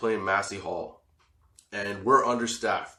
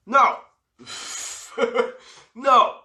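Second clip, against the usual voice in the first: high, loud laughing No; laughing denial; 11 semitones higher, 5 dB louder than usual. Real, not acted. Storyteller laughing at his own naive thought, high loud no. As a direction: cracks up while denying it. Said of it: "emphatic denial, laughing".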